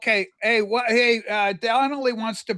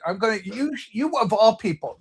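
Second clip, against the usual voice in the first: normal talking voice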